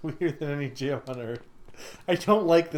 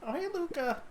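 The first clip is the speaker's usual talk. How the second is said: put-on much higher, soft kid greeting; light child's hello; 12 semitones higher, 7 dB quieter than usual. Bug kid greeting Luca in a higher voice.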